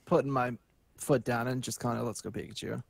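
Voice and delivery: ordinary talk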